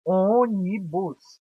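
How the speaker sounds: Ônibus broken slowly into separate syllables; split into syllables. Shown as a demonstration. Teacher showing students how Ônibus splits, slowly. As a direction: sound out Ônibus beat by beat. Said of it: "nós inconscientemente já fizemos a separação".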